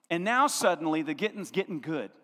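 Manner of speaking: ordinary delivery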